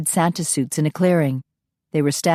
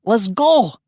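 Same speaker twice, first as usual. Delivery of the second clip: high loud yell; urgent yell to get away; 6 semitones higher, 4 dB louder than usual. A narrator's reading. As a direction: call out to a comrade. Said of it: "Yuri yelled".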